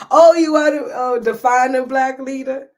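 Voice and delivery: high, loud surprised reply. Real, not acted. Reacting aloud to a viewer's comment, surprised. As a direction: caught off guard by the question.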